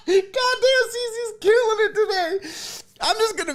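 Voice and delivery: high, squealing through laughter; real laughing fit; helpless laughter. Real, not acted. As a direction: wheeze it out while cracking up. Overcome by laughter.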